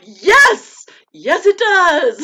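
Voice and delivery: high, loud, slow drawn-out yes; exaggerated agreement. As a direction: stretch it out in mock misery.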